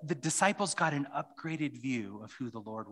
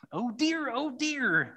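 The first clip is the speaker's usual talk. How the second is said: put-on whiny little girl Lucy; 12 semitones higher, slower than usual. Preacher voicing Lucy to the congregation as a high, wailing child. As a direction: pitch it up like a distraught child. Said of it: "says Lucy".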